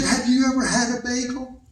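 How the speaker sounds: softly precise "Have you ever had a bagel"; enunciating wife. Retold or imitated. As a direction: give each word of "Have you ever had a bagel" its own space. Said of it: "She enunciates".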